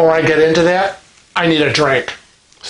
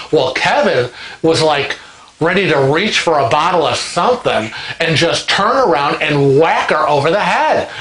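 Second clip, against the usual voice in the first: normal talking voice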